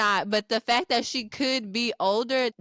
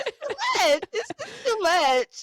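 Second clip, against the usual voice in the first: high, half-laughing whine; creeped-out wail; 11 semitones higher than usual. Real, not acted. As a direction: wail it as if close to tears, laughing through it. Mock wailing at being creeped out.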